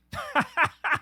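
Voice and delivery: sudden high burst of laughter; incredulous real laugh. Real, not acted. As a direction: laugh out in disbelief. Reacting to absurd quotes.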